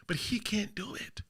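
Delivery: a whispered dig. Real, not acted. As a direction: drop to a whisper, hushed. Mocking Jey Uso, quiet aside.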